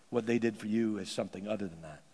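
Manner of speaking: ordinary talk, nothing marked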